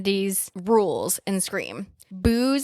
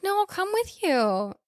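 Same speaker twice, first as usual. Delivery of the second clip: high-pitched imitation of the girlfriend; mock girlfriend voice; 10 semitones higher, 3 dB quieter than usual. Co-host voices the girlfriend offering to come along, mock high voice. Voicing the girlfriend in retold scene.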